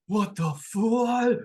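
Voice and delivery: game master voicing a stunned ship's crew, shocked. Gasping crew to the surfacing strangers, shocked.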